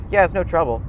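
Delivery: ordinary talk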